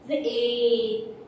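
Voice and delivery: drawn-out vowel demo; long drawn-out a. Shown as a demonstration. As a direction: stretch the sound ए long and slow. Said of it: "ए को लंबा खींच के ले जाना है".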